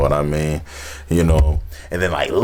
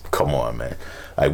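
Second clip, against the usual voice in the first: ordinary delivery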